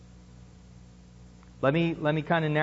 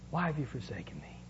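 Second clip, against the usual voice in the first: hushed broken whisper; 2 semitones lower, 12 dB quieter, faster than usual. Acted. Preacher echoing Jesus on the cross in a whisper. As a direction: breathe it out, barely audible. Quoted cry repeated hushed.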